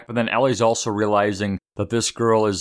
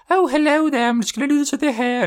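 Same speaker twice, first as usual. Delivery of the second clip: much higher put-on hairdresser voice, quick on the second line; fake hairdresser voice; 4 dB louder than usual. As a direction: squeal it out as a pretend hairdresser. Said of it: "pretends to be the, whatever, the, the, the hairdresser".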